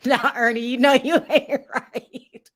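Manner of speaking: real laughter running through the words; laughing. Real, not acted. Host chides guest for his joke about her clothes, laughing. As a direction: scold him through laughter, drawn out.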